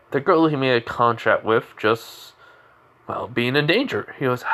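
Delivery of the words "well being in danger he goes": ordinary delivery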